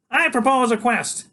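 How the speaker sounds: put-on small boy waking his big friend for a quest; eager boy genius. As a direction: rouse him like a tiny herald. Freak voiced.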